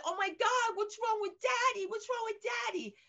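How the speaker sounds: put-on high, alarmed child voice; squeaky kid panicking over Daddy; daughter's voice. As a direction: mimic a panicked little girl. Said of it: "she said".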